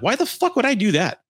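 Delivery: high-pitched retold outrage; reenacted indignant retort; indignant disbelief. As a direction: throw the question back incredulously, pitch up. Retold quarrel with friend.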